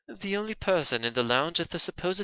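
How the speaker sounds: soft, reflective character voice; thoughtful game defendant. Acted. Accused weighing the witness's conclusion, soft character voice. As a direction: reason softly as the accused.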